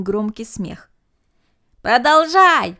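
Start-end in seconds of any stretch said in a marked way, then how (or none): 1.8-2.8 loud high cheer; loud cheering call; shouting. A narrator's reading. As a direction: urge them on loudly. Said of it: "закричали ребята".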